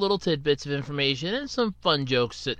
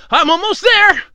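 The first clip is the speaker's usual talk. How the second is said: climbing stairs; 13 semitones higher, 9 dB louder than usual. Acted. Host rushing up the stairs.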